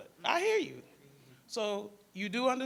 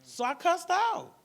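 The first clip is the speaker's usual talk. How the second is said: defiant admission; 11 semitones higher, 7 dB louder, slower than usual. Retold or imitated. Teen girl admits cussing the counselor out.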